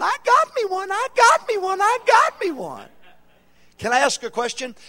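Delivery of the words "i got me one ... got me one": high excited screaming; retold scream of joy. Woman running across the lot, screaming with joy. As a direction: shriek it out like she just won.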